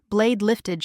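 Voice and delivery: usual speaking voice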